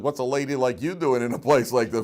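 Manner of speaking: teasing comeback; mock pointed question. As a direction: turn the question back on her. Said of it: "the obvious question is".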